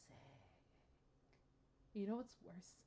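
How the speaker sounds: ordinary talk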